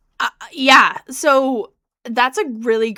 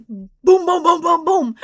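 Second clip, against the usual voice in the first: voiced booms climbing higher on each knock; mock booms of a beast breaking down the door; door pounding; 8 semitones higher, 5 dB louder than usual.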